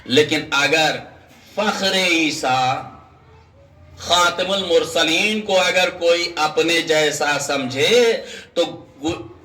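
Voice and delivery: ordinary talk, nothing marked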